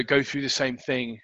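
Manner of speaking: ordinary delivery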